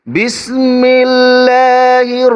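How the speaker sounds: melodic, drawn-out tajweed chant; Quran recitation. Shown as a demonstration. Teacher recites for students, in melodic Quran recitation. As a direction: recite slowly with a lilting tune. সুন্দরভাবে শুদ্ধভাবে পড়তেছি.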